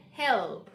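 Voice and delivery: careful, falling help; dark L. Shown as a demonstration. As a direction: roll the tongue on the L in help. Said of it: "correct pronunciation of this is help".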